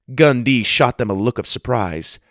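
ordinary delivery, nothing marked

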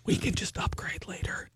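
hushed conspiratorial whisper; whispered aside. Retold or imitated. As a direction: whisper it to Colin. Aside to Colin at planning hour.

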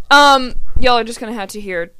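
usual speaking voice

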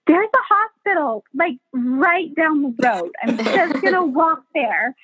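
high-pitched imitation of a fed-up woman in labor; retold pleading client. Laboring client telling midwives she's leaving, imitated high insistence. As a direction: start high, playfully mimicking her insistence. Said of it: "looking at us and like".

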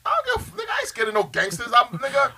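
high, fast and defiant. Real, not acted. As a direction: fire it back, cocky and fast. Heated boast about gangsters.

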